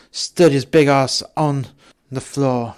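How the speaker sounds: ordinary delivery, nothing marked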